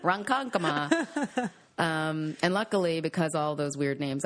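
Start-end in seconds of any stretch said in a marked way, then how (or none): 0.0-1.5 slow, careful Ronkonkoma, every syllable drawn out; drawn-out place name. Shown as a demonstration. Listing odd Long Island town names, drawn out slowly. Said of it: "I had the pronunciation".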